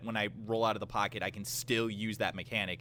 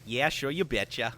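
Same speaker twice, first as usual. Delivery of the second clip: hammed-up northern Minnesota accent. Shown as a demonstration. Podcast guest obliging the host's request, put-on northern Minnesota accent. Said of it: "can we hear your good, like, northern Minnesota accent?".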